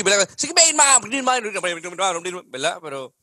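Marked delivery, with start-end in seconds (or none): 0.4-1.5 put-on hillbilly accent. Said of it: "los hillbilly".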